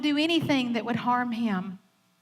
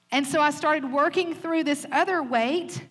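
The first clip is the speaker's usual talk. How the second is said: normal talking voice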